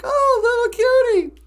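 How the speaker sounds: high, loud skunk hello; delighted greeting. Acted. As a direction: greet a dear friend, overjoyed to see him. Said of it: "He's just happy to see him".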